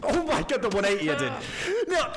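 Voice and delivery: high, loud shriek of amazement; excited yelp. Real, not acted. Gamer shrieking at his own one-eighty spin. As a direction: squeal at your own crazy move.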